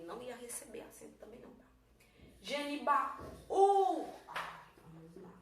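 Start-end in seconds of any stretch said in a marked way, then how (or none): 2.4-4.1 "Genibaú" slowed, with long high "ú"; pause, then hold the "ú" of "Genibaú"; drawn-out emphatic "Genibaú"; stretched final syllable. Shown as a demonstration. Teacher sounds out the stressed hiatus.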